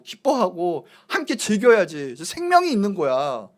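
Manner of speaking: lightly voiced Jesus, emphatic and drawn out; acted Jesus. Jesus telling gloomy guests to rejoice while he is here, acted urging. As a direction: urge them earnestly to join the joy. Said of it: "예수님 그 말이에요".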